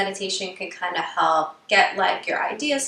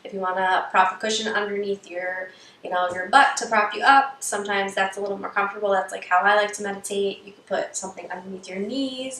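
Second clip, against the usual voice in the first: usual speaking voice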